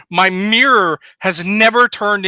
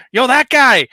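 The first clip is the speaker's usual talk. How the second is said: high, loud mock call-out; 6 semitones higher, 6 dB louder, slower than usual. Host acting out pointing at someone on screen, calling out. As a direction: shout it as if singling someone out.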